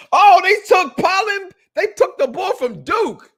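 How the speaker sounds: high, loud, drawn-out exclamation; excited outburst. Real, not acted. Host reacting to a draft pick, excited outburst. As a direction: holler it in astonishment.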